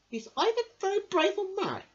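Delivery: high, loud animal voice; amazed forest creature. Acted. Creature wondering about the mouse, high and amazed.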